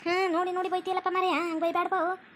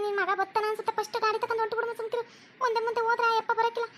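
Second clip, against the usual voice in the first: urgent urging; 4 semitones higher than usual. Acted. Daughter-in-law urges mother-in-law.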